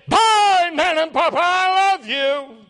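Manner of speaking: imitated little granddaughter's high, loud farewell; child calling out. Granddaughter calling to grandparents past airport security, imitated shout. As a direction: shout it high, stretching out the last words. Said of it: "begin to jump and say".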